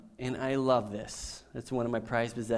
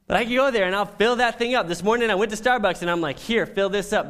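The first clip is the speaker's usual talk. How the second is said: fast and louder, bubbling with glee; excited, fast; 7 semitones higher, 6 dB louder, faster than usual. Real, not acted. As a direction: rush it out, thrilled about the free refills. Excited coffee refill story.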